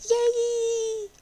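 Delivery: put-on high, loud girlish squeal of joy; excited cheer. Friend delighted by the promise, excited cheer. Voicing a delighted girl in game.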